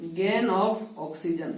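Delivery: slow, deliberate, each word laid out; dictating a key term slowly; slow teaching emphasis. Shown as a demonstration. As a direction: spell it out slowly for the class. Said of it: "मतलब ये गेन कर लिया".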